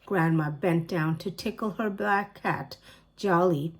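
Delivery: ordinary delivery, nothing marked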